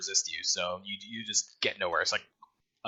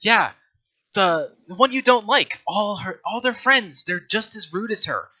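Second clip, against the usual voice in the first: higher, louder voice coaxing a simple lackey; coaxing boss; 7 semitones higher, 5 dB louder than usual. Acted. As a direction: play along with a simpleton's grievance. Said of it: "She's like".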